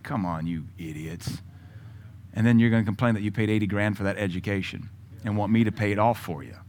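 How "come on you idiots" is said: low, soft, slow mutter; exasperated aside. Retold or imitated. Pastor scoffing at critics, a low, exasperated mutter. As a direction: let it drop out under your breath. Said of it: "I'm just like".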